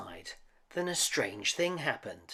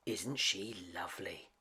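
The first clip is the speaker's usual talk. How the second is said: softer, lower hushed voice; whispering; 5 semitones lower, 8 dB quieter than usual. A narrator's reading. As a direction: hush it so Belle won't hear. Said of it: "whispered Missus Potts".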